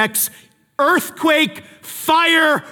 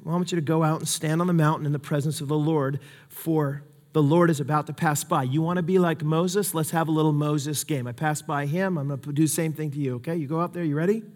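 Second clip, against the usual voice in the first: softer, calm and patient; gentle God; 3 semitones lower, 7 dB quieter than usual. Retold or imitated. God gently inviting Elijah onto the mountain, softly. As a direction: speak kindly to a tired friend. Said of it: "And then the Lord said".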